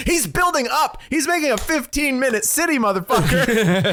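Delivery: mock alarm, laughing at the end; mock outrage. Real, not acted. Podcast host mock-rants about the mayor's city plan, hammed-up outrage.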